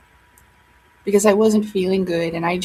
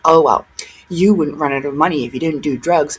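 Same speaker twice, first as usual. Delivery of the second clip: mock smug commenter; 6 semitones lower than usual. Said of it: "And you think".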